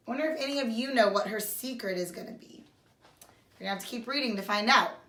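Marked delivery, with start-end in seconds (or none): none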